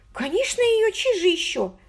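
put-on high, eager girlish voice; an excited young woman confiding. A narrator's reading. Margot voiced by the narrator.

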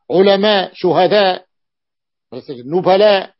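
علماء، شهداء، نبلاء loud, each opening with u; u on first letters. Shown as a demonstration. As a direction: list علماء، شهداء، نبلاء emphatically as ulama, shuhada, nubala. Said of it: "كلها بضم الفاء".

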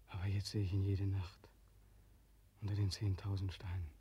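soft haunted whisper; a father whispering of his dead child. Acted. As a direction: whisper it like a nightmare. Haunted monologue about dead son.

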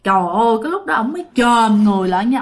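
plain reading voice

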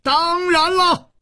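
very high, drawn-out scream; roaring. A narrator's reading. Li Yuhan defends his wife to Hu Tiehua, roaring. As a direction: scream the answer back at full stretch. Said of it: "李玉涵也大吼着".

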